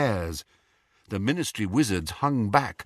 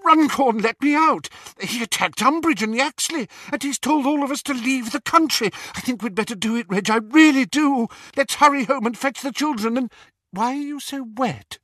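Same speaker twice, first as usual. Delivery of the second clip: high, breathless wife's voice; relieved wife; 12 semitones higher than usual. A narrator's reading. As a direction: pour it out to her husband in a rush. Mrs Cattermole voiced, relieved wife.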